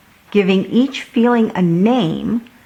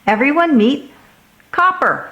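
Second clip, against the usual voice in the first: proud reveal; 8 semitones higher than usual. A narrator's reading. Sam proudly shows his dog.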